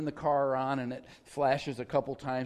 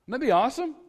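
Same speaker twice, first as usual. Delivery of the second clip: excited; 8 semitones higher, 4 dB louder, much faster than usual. Real, not acted. Pastor enthuses about church code reader.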